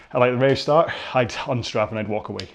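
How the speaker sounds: normal talking voice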